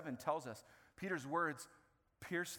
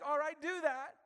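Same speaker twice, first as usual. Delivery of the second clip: much higher and louder, pushing forward; excited high urging voice; eager Peter; 11 semitones higher, 6 dB louder than usual. Retold or imitated. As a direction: Peter cheering them on to go tell everyone. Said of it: "He's like".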